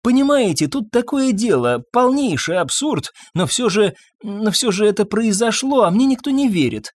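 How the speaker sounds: high, insistent pleading; agitated doctor. A narrator's reading. Doctor explains an absurd affair nobody believes, agitated. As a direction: plead to be believed, pitched well up.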